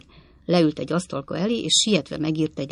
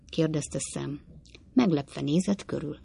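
ordinary delivery

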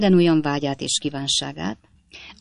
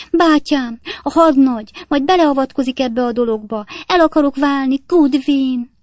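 much higher, louder hysterical threat; hysterical wife; 9 semitones higher, 5 dB louder than usual. A narrator's reading. Wife announcing divorce to her maid, high and hysterical. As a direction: threaten divorce in a tearful fit.

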